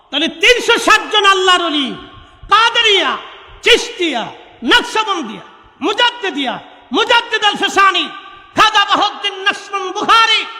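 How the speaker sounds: high, loud roll call of saints; full-force preaching. Real, not acted. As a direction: proclaim each name to the crowd. (উচ্চস্বরে ওলিদের নাম ঘোষণা)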